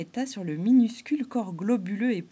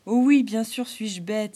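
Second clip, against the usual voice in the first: louder, slower playful exclamation; exclaiming; 2 semitones higher, 8 dB louder than usual. Acted. Clown admitting his blunder to the beaver, exclaiming. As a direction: cry out at his own silliness. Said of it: "s'écria Arlequin".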